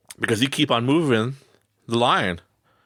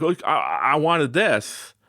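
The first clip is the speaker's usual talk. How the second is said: whiny; 7 semitones higher, slower than usual. Retold or imitated. Whiny self.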